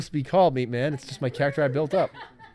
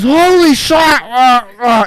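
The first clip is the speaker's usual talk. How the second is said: much higher, loud shocked yell; shocked shout; 11 semitones higher, 11 dB louder, much slower than usual. Retold or imitated. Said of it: "The guy goes".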